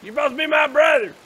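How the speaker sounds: loud, high betrayed shout; mock outrage. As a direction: yell at him, playfully wounded. Mock betrayal outburst at friend.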